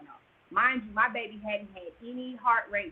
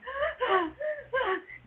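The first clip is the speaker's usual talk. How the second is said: high, sobbing gasp; pained gasp. Retold or imitated. Patient as the catheter brings on the pain, pained gasp. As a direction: gasp as if pain suddenly hits. Acting out sudden labor pain.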